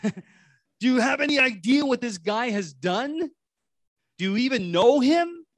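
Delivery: high, loud, outraged protest; hammed-up appalled objection; indignant Peter. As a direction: burst in with disbelief, stretching the questions. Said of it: "Peter says".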